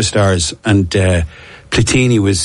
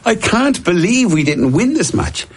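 mock disbelief; 11 semitones higher, slower than usual. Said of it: "you're going".

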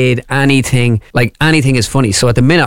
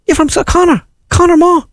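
very high-pitched North Side Dublin delivery man; comic high north Dublin voice; north side Dublin accent. Acted. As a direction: squeal it up high in broad Dublin. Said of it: "a North Side dub".

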